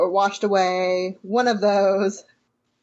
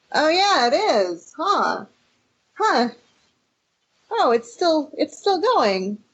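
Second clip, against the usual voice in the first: higher, slow, unbothered musing; slow mock-casual, higher; mock chill; 9 semitones higher, slower than usual. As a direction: play it laid back as the shaking goes on. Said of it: "are you really that chill".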